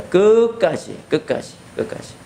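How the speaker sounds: emphatic 끝까지 dropping to a whisper; rising then fading emphasis. Real, not acted. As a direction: punch 끝까지 then let it die away. Preacher builds emphasis.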